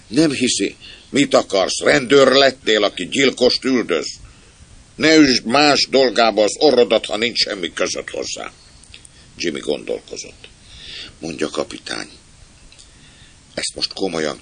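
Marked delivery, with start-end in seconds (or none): 0.1-8.5 loud, high-pitched telling-off; irritated rebuke. Acted. Warning someone to stay out of others' business, irritated. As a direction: tell off a meddler, voice raised.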